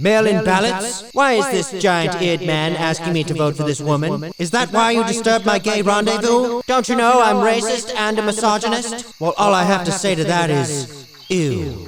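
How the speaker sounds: loud ghostly outrage; loud outraged ghost; booming ghost. Acted. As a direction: scold them as an offended spirit. Ghost scolds the visitors.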